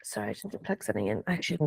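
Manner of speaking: quick whispered aside. Real, not acted. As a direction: whisper it fast, off mic. Aside while plugging something in.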